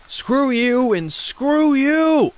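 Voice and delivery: slow, shrieking rage; infuriated yell. Acted. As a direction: scream it at him with everything. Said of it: "I yelled, infuriated".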